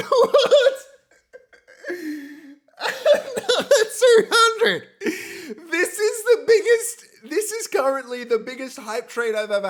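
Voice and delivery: high, loud laughing disbelief; laughing shout. Real, not acted. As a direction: laugh it out, overwhelmed. Laughing at more donations.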